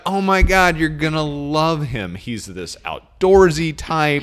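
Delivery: loud, high, hammed-up excitement; mock-thrilled, drawing out the selling points; gushing friend. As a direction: rave like a friend setting up a date. Acts out a gushing matchmaker friend.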